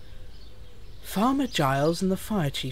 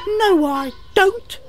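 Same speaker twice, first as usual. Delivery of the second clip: very high, slow engine squeak; affronted high-pitched little engine; indignant; 6 dB louder, slower than usual. Acted. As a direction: protest in a loud drawn-out high voice. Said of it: "Thomas replied indignantly".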